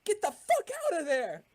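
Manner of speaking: high, urgent yell to companions; in-character yell. Acted. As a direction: yell a high-pitched warning to your friends. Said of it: "I'm just yell".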